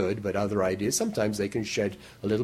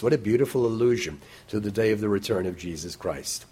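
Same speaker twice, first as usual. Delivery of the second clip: ordinary delivery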